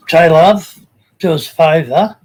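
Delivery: loud, friendly hail of a name; calling out. Real, not acted. Streamer asking a viewer for a favour, loud and friendly. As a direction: shout the name to grab his attention.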